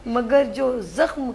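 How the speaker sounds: slow, heartfelt verse recital; poetry recitation. Acted. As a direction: recite a farewell couplet with feeling. Anchor's farewell couplet. (جذباتی انداز میں شعر پڑھنا)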